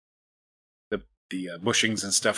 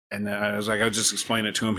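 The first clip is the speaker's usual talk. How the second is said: normal talking voice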